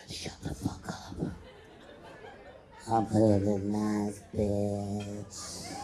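put-on slurred, whispery drunk girl, dragged out slow; drunk woman. Drunk friend hushing in the hallway, slurred and whispered. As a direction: play the wasted friend mumbling in the hall.

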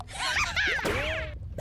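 high mocking cackle; mocking laugh. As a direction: laugh at their fall. Laughs at fallen rival.

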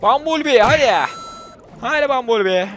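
loud, high cheer for Bumblebee; excited cheering. Real, not acted. Gamer cheering his robot character with a loud high call. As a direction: hype up the robot like a fan.

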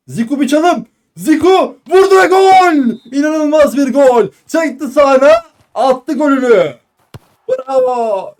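high, loud goal scream; screaming football commentator. Real, not acted. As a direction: roar the goal call. Goal call in live commentary. (bağıran futbol spikeri)

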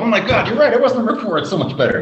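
high, loud, laughing disbelief; excited realization. Retold or imitated. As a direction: gasp it out with a laugh. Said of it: "I'm like".

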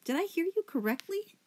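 very high, incredulous question; shocked squeak. A narrator's reading. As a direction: squeak it out in disbelief. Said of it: "her voice half gargle, half squeak".

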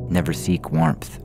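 usual speaking voice

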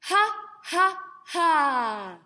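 loud, resonant chest ha's; booming chest-voice ha ha ha. Shown as a demonstration. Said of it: "Can you do that".

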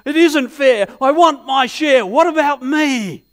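put-on loud, high cry of a hurt child. Preacher acting out a boy crying out for his share, loud. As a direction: shout it like a little boy who can't get served. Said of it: "he just cries out at the top of his voice".

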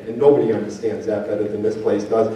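ordinary delivery

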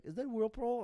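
high, imitated child question; son's voice. Son asks dad about whirlpool, high child voice. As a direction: ask it like a curious kid. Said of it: "He's like".

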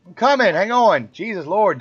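real loud, high-pitched exasperated holler; exasperated shout. Real, not acted. Parent yelling back at the household to hang on, exasperated shout. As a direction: call out impatiently to someone demanding you.

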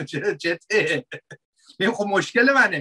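normal talking voice

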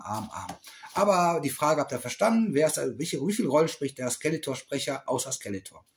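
ordinary talk, nothing marked

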